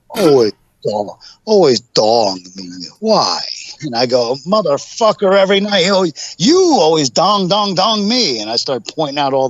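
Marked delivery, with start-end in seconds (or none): 0.1-3.4 slow, drawn-out protest imitating his friend; lightly voiced plaintive objection; mock complaint. Quotes Onita's protest at being hit. 4.5-8.4 high-pitched, hammed-up indignant protest; play-acted comic outburst; mock outrage. Acts out his heated comeback.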